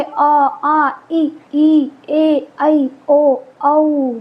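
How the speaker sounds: the Hindi vowels in sounded pairs; vowel sounds recited. Shown as a demonstration. Teacher reciting the Hindi vowels, one pair at a time. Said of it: "स्वर कौन से होंगे?".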